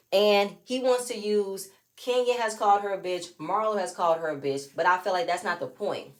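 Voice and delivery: ordinary delivery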